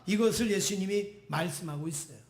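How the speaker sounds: ordinary delivery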